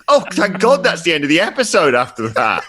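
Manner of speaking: high, emphatic relieved exclamation; retold relief. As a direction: recount it with exaggerated relief. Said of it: "I was like".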